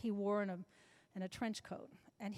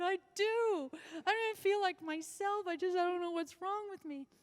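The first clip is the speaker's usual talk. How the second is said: distressed plea; 8 semitones higher than usual. Retold or imitated. Retelling her plea to doctor.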